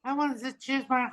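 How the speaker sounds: very high, soft boxer voice; Mike Tyson. Acted. Comic tries Tyson's roast line, high failed Tyson impression. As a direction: strain up into a small high voice, badly faked. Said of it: "I can't even do Mike Tyson".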